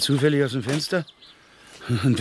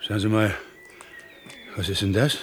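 usual speaking voice

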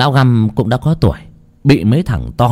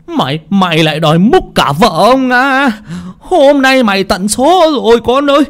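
furious husband; 11 semitones higher than usual. Acted. Rich husband threatening the man who touched his wife, high and enraged. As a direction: spit out the threat, rising in rage.

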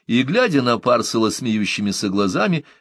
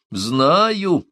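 drawn-out, raised outburst; rumbling exclamation; 7 semitones higher, 3 dB louder than usual. A narrator's reading. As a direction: let it roll out with force. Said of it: "пророкотала она, словно водопад обрушился". (раскатистое восклицание)

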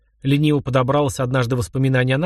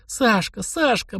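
высокий девичий голос, два коротких возгласа; повтори имя высоко, на выдохе радости от встречи; бормотание растроганной девушки; 8 semitones higher, much slower than usual. A narrator's reading. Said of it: "бормотала она". (растроганно бормочет, высоким голосом)

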